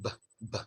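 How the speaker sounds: b, lips closed then popped open; b released with a puff; B demo. Shown as a demonstration. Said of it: "release it".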